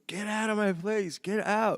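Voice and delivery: high yelled order to clear the room; high, loud, slow yell at drunk kids; screaming. Retold or imitated. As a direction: scream them out of the place. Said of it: "I'm screaming".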